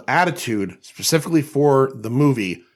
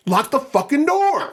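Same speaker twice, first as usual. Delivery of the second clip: yelling; 12 semitones higher, slower than usual. Retold or imitated. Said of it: "I literally have yelled".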